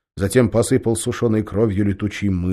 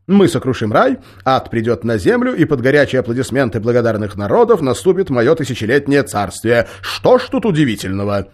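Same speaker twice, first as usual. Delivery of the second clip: louder, grand gloating demon; boastful devil; 5 semitones higher, 8 dB louder than usual. A narrator's reading. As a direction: proclaim it like a conqueror.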